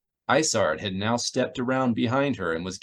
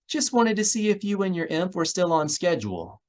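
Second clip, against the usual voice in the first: Miranda's voice; 8 semitones higher than usual. Acted. Miranda calls on comlink.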